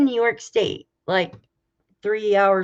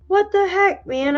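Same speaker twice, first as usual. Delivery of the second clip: high, exasperated what the heck; mock-annoyed outburst at a broken pump; retold frustration; 11 semitones higher, 4 dB louder than usual. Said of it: "I'm like".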